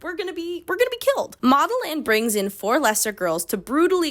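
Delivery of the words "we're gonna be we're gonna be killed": mock scared. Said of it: "now she's getting scared".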